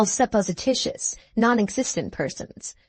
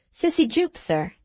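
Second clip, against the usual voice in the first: higher, lightly voiced little girl, bashful; a blushing pupil answering her teacher; shy schoolgirl; 5 semitones higher than usual. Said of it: "explained number twenty, blushing".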